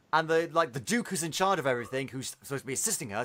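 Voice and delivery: ordinary talk, nothing marked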